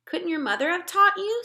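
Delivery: put-on a small girl asking politely; little girl Sophie. Sophie's voice, higher.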